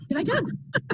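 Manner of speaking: high, startled little question. Retold or imitated. Group member startled when the coach calls on her. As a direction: play the surprised group member caught off guard.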